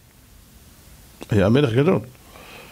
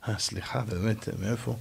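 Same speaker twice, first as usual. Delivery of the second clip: much softer, lower, embarrassed; sheepish apology; 7 semitones lower, 13 dB quieter than usual. Acted. Solomon to the ant, apologizing very softly and low. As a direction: mumble it like a scolded king.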